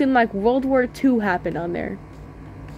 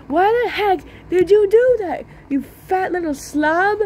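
high, mock-angry yell at a fat kid; 7 semitones higher than usual. Older kid scolding the boy who knocked him down, in a shrill mock scold. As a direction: shriek the insult like an offended teen. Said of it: "he said".

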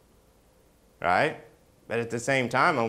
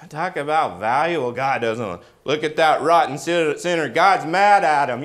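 ordinary talk

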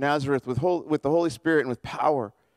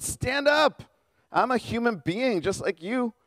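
sharp, much higher pitch on stand up; insistent; 8 semitones higher, slower than usual. Acted. Peter telling kneeling Cornelius to rise, urgent and high. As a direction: hurry him back to his feet.

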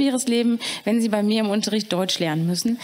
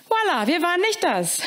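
higher, earnest learner's German; student's protest; 7 semitones higher than usual. Acted. Student Suleiman to the caretaker, earnest denial. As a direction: stress nicht, deny it firmly.

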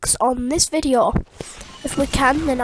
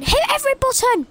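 squeaky voice; 11 semitones higher, faster than usual. Pretend. Joke in outro.

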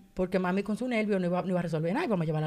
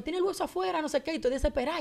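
higher, soft-voiced panicky report; desperate retelling; 6 semitones higher, 4 dB quieter, faster than usual. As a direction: retell a frantic call for help in a raised pitch. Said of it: "yo estoy desesperada".

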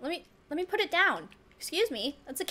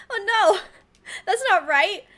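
a sudden jolt of alarm, louder and higher; 6 semitones higher, 6 dB louder than usual. Real, not acted. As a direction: caught off guard by a mistake. Potato cut wrong, panics.

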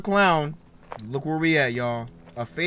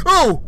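high, loud shriek of surprise; sudden real fright scream; startled yelp; 11 semitones higher, 9 dB louder than usual. Real, not acted. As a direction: yelp as if jump-scared. Startled by the game.

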